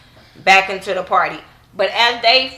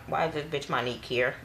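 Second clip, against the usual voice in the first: under-breath thought; 6 semitones lower, 11 dB quieter than usual. Retold or imitated. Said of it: "I was looking like".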